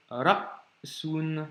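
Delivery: slow "ra'sun", long silent stop after "ra"; two-part demo of "ra'sun"; complete blockage. Shown as a demonstration. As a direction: cut "ra" dead, wait, then "sun". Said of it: "vraiment blocage complet".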